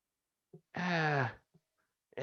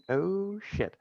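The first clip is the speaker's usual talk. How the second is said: a higher, deflated oh shit; dismayed oh shit; 8 semitones higher, 6 dB quieter than usual. Retold or imitated. Player's character staring at the broken sword, dismayed. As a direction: sag with a mock sinking feeling. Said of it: "you're like".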